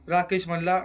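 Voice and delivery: normal talking voice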